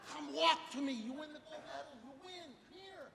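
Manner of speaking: high-pitched coaxing that drops in volume; pleading coach. Retold or imitated. Coach begs his runner to finish, high pleading. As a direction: coax the child over, high and urgent. Coach coaxes girl to the line.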